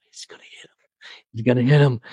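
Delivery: tense unvoiced whisper; inner whisper. Watching Dylan swing the guitar, nervous whisper. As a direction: hiss it to yourself in alarm. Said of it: "I think".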